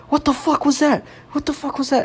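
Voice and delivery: high, hushed mock freak-out; imitated panic. Friend rounding the corner after fleeing, imitated high panic.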